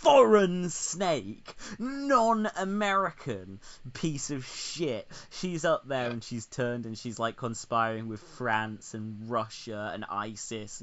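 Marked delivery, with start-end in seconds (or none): 0.0-5.1 high, drawn-out hammed-up xenophobic insult; mock outrage. Mock patriot denouncing the British PM, hammed-up outrage. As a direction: stretch the insults out slowly in fake fury.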